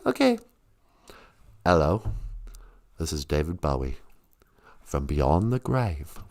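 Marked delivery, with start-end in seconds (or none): 1.7-6.2 low ghostly rock star from beyond. Retold or imitated. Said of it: "How about [name]?".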